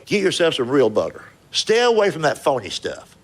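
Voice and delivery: ordinary talk, nothing marked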